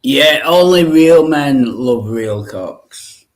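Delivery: hammed-up macho pride, drawn out; mock macho declaration. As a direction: puff up and declare it like a he-man.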